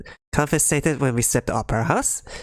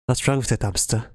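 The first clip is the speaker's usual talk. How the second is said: ordinary talk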